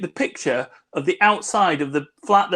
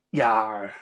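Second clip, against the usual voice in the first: pirate voice; 7 semitones lower, 5 dB quieter than usual. Retold or imitated. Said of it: "he gets pirate eye".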